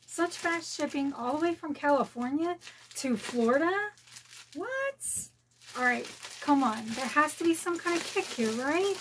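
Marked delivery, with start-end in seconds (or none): none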